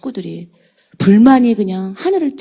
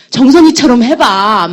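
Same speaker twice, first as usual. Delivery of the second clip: louder, drawn-out nudge; acted urging; 3 semitones higher, 7 dB louder, slower than usual. Relatives chatting among themselves about the business, drawn-out urging. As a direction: stretch it out as a loud piece of advice.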